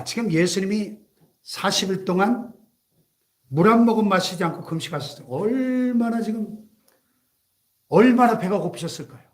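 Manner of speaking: normal talking voice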